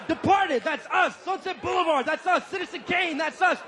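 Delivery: high, hyped-up list building to a shout; gloating crowing. Acted. Imagined gloating chant, building to shout.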